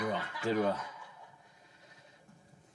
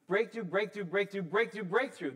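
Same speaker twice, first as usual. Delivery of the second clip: preaching; 7 semitones higher than usual. Acted. Preaching at full force.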